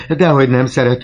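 high, loud protesting cry; kiáltás. A narrator's reading. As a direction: cry out to defend her. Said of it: "kiáltotta Gorió". (apa hangosan tiltakozik lánya mellett)

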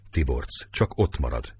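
ordinary delivery, nothing marked

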